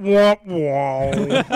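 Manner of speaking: loud sung womp womp; sad trombone. Acted. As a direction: imitate a failure sound effect. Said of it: "[name] will put out".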